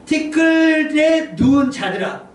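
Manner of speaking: high, loud, very slow call; drawn-out proclamation. Acted. Preacher calling those turned to dust, drawn-out proclamation. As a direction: summon the dead, stretching each word. Repeats verse after 더 심각합니다, stretched out.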